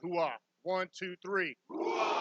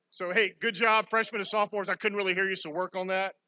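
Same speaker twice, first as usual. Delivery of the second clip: ordinary delivery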